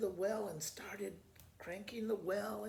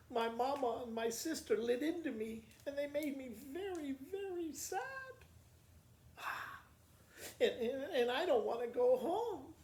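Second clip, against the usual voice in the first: put-on higher, tearful-sounding girl; upset girl; 6 semitones higher, 3 dB louder than usual. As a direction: complain through tears as the girl. Crying girl answers the lady.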